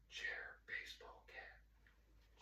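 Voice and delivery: soft unvoiced whisper. Shown as a demonstration. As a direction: whisper each word slowly. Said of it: "I'm gonna whisper three words".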